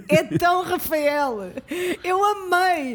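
can't stop laughing at how typical he is; slow amused laugh through the words. Real, not acted. Friend laughing about Rafael.

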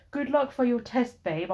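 high-pitched simpering good wishes; mock simp. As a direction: imitate a fawning simp, starting high. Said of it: "being like a total fucking simp".

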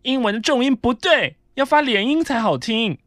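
mock prissy correcting. Quoting coworker correcting English.

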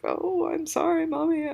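put-on very high little-kid voice; imitated apologetic child. Tough little son apologizing to upset mom, high imitated child voice. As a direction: play a small child saying sorry sweetly. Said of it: "and so he's like".

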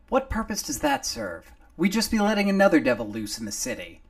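loud, fast, indignant; heated objection. Acted. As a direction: push back hard. McGrady objects.

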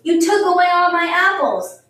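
high, loud wail of protest; child's loud accusation. A narrator's reading. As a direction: shout it like a robbed kid. Children quarrel over taken apples.

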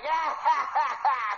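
mock creature cackle; Shrieker laughing. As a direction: imitate a creature laughing at an argument. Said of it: "was the Shrieker actually laughing".

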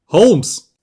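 high, loud, insistent call. A narrator's reading. Visitor trying to rouse the unresponsive patient, high loud call. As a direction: call out hard to wake him. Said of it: "rief er von neuem".

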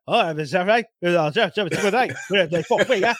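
put-on muddled Cockney gibberish British accent. Host imitating Abu walking in, in a garbled British accent. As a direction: do a blended crazy mess of Cockney British. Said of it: "Abu has a British accent".

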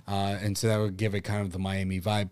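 ordinary delivery

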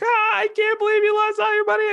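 hammed-up, very high-pitched sobbing wail; mock weeping. Girlfriend hears he lost all the money, mock weeping. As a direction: imitate a bawling girlfriend in squealing falsetto. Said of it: "like, weeping, just crying".

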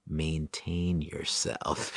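slow, softened, measured advice; Buddha-like calm. Retold or imitated. Stoned friend steadying his panicking buddy, Buddha-like calm. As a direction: stretch it out quietly like a serene guru. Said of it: "This dude's like a Buddha".

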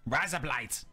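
imitation Australian; Australian accent. Shown as a demonstration. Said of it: "razor blades in Australian".

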